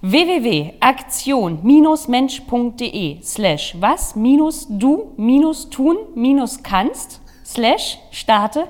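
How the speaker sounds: spelling out a web address slowly; slow dictation. Shown as a demonstration. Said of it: "jetzt muss es noch einmal sagen".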